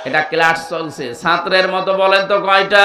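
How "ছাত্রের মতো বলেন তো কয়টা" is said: urging shout. Real, not acted. Urging audience to answer.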